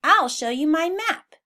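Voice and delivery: a hint of eagerness; eager. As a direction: show off the map. Henry leads animals.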